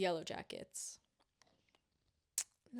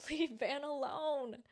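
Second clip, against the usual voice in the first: pleading through a laugh, a little higher; laughing plea; 6 semitones higher, 5 dB louder, slower than usual. Real, not acted. To the show writers, a laughing plea. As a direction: laugh while you beg them.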